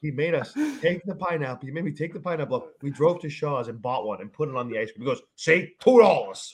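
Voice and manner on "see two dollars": high, loud, drawn-out gloat; triumphant dad. Retold or imitated. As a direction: imitate a father proving his point. Father triumphantly proves his point.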